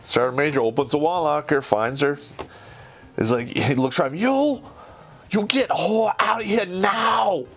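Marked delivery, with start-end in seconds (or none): 4.2-7.4 much higher, slow shouted broken English. Retold or imitated. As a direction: order her out like the sergeant major. Sergeant major finds the girl.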